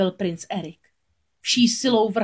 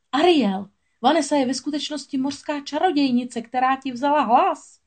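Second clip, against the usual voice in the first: high, urgent agitated call; agitated warning; 7 semitones higher than usual. A narrator's reading. Filip warning Ariel the bride is a witch, agitated and high. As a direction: blurt it out, alarmed and breathless. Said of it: "rozčilený Filipův hlas".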